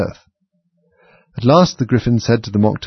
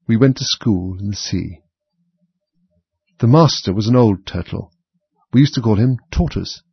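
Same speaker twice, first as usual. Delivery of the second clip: calm, mournful recollection; 3 semitones higher, 4 dB louder than usual. A narrator's reading. Mock Turtle tells Alice of school in the sea, calm after sobbing. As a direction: recall it composedly after tears. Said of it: "more calmly, though still sobbing a little".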